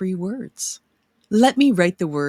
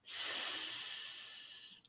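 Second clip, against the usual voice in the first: long unvoiced hiss on S; drawn-out s like a snake; 10 dB quieter than usual. Shown as a demonstration. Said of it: "Say the sound".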